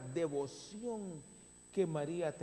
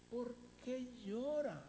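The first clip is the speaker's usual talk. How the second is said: gentle rebuke; 8 semitones higher than usual. Shown as a demonstration. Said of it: "como los ángeles".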